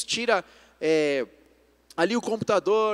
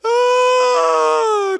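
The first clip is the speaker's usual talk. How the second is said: loud, high-pitched imitated tantrum wail; mock child crying; 11 dB louder than usual. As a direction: wail like a toddler starting to cry. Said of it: "começou a chorar".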